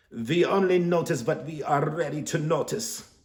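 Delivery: loud, high call from the doorway; yelling in a thick accent. Acted. Psychic yelling at Stanley from her door in a thick accent. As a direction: yell it out in a heavy accent. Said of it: "yells in a thick accent".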